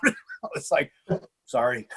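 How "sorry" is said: small, low, soft mock apology; sheepish apology. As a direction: shrink down like someone told off for shouting. Sheepish reply to executives' scolding.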